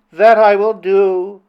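high, slow, emphatic princess voice; submitting princess. Acted. Princess acknowledges Flynn's sway, submitting. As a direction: give a solemn pledge as the princess.

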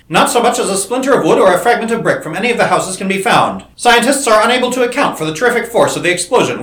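character reading the paper aloud, loud bulletin style; newspaper headline read. A narrator's reading. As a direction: announce it like a breaking news report.

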